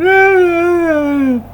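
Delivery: shrill high mocking cackle. Real, not acted. Laughing at the crying deleters in a high cackle.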